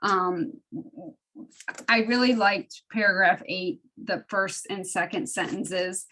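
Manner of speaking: ordinary talk, nothing marked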